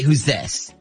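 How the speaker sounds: usual speaking voice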